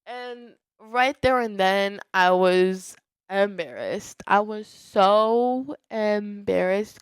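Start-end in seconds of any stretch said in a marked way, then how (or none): 2.1-7.0 slow, stretched-out confession of embarrassment; drawn-out emphasis. Real, not acted. Podcaster confessing classroom embarrassment to listeners, drawn-out and emphatic. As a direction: drag out each word for emphasis, especially SO. Stressing how embarrassed she was.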